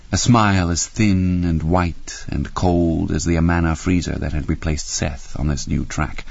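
ordinary delivery, nothing marked

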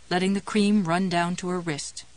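plain reading voice

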